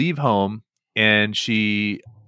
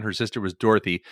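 ordinary delivery

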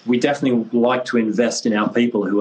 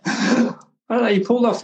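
laughs before answering; amused laugh then speaks; 12 semitones higher than usual. Real, not acted. Laughing at bald joke.

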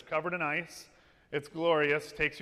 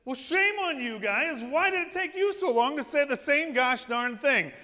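John the Baptist rebuke; 6 semitones higher, 4 dB louder than usual. Retold or imitated. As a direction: scold the parents like a lightly voiced prophet. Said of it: "I channeled my inner John the Baptist".

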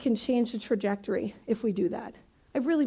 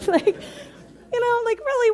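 laughing; 11 semitones higher, 3 dB louder than usual. Real, not acted. Laughing at own joke.

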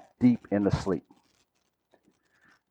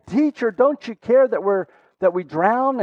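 higher-pitched imitation of a cry over noise; retold desperate plea; 7 semitones higher than usual. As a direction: plead urgently, without really screaming. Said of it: "you know they're screaming over the, the noise".

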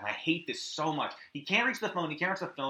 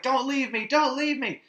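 high, pleading, hammed-up desperation; mock desperate plea; 10 semitones higher, 4 dB louder than usual. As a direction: beg him not to go, rising. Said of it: "Lee Waddle's like".

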